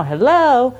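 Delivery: high, loud sing-song chime; imitated ice cream truck chime. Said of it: "like an ice cream truck".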